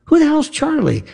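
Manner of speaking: high, sharp disbelief; mock incredulous question. Interrogator scoffing at a killer, high and incredulous. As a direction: demand it like a skeptical cop.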